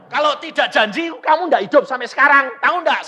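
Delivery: high, loud and quick self-defence; worked-up husband in a quarrel; heated acted retort. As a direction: argue back loudly at your wife. Acted quarrel with his wife.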